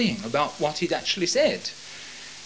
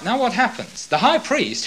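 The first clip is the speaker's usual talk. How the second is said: normal talking voice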